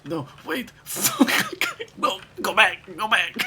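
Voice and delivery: high, laughing panic calls; frantic. Real, not acted. Gamer missing the boat, frantic high laughing shouts. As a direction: yell for the game to go back.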